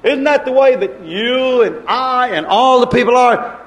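ordinary talk, nothing marked

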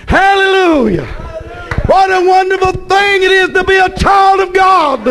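rising to a full pulpit cry; shouted praise. Acted. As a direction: shout it out to the whole church.

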